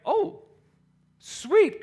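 high-pitched acted delight; pleasant surprise. Lifter realizing the heavy lift worked, pleasant surprise. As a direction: lift the voice high, happily amazed.

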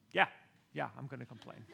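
muttered defiance. Retold or imitated. Defiant reply.

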